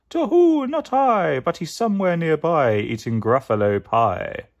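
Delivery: high, loud hooting owl. Acted. Owl answers the Gruffalo's child, high and hooting. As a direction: hoot the first word like an owl. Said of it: "Down flew the creature".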